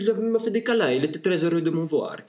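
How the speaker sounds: ordinary talk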